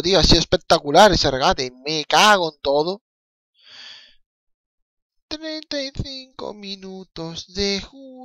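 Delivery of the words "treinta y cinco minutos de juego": higher, softer, slow match-time call; mock announcer. Fan narrating the match to viewers, slow higher commentator call. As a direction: call the clock like a tired commentator.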